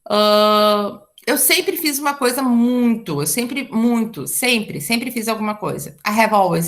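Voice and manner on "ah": ordinary delivery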